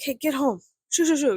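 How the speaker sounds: shooing dogs. Retold or imitated. Talking to neighbors' loose dogs, acted shooing. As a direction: re-enact shooing a stray off home.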